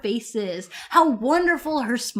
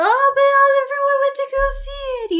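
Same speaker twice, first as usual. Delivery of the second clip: much higher mocking singsong; 13 semitones higher, 4 dB louder than usual. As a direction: parody the other person. Mocking opponent's argument.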